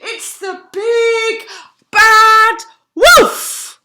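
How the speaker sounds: high loud terrified shout; cried out. Acted. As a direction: scream the alarm. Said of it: "he cried".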